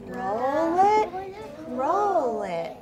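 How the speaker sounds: slow, sing-song read-aloud, stretching each word; pointing at each word as you read slowly; reading a poem to children. Acted. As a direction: lead the class through the poem line by line. Teacher reads poem aloud.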